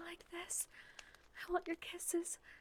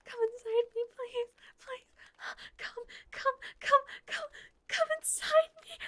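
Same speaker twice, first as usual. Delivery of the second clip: rising, louder, higher pleading cries; climax cries; 7 semitones higher, 8 dB louder than usual. Acted. Wife crying out to her husband, building cries. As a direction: let the cries build to a peak.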